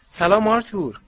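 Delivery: high, loud welcoming call; called-out greeting. A narrator's reading. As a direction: call out hello to your husband arriving. Calling greeting to arriving husband. (سلامِ بلند و زیر)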